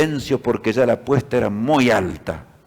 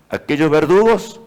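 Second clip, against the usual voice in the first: ordinary talk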